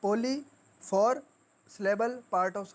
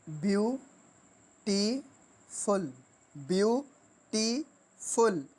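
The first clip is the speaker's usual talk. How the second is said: Beautiful very slowly in three separate beats; three-syllable split; much slower than usual. Shown as a demonstration. Teacher counting three sounds, beautiful broken syllable by syllable. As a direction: pause between view, टी and फुल. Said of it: "तीन sound".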